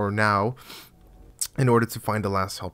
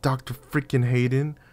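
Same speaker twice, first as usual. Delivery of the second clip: higher, outraged disbelief at the name; heated outrage; 5 semitones higher than usual. Real, not acted. Gamer told he needs Doctor Hayden's help, heated disbelief. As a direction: rant in angry disbelief, pitch climbing. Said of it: "I'm getting a little heated up".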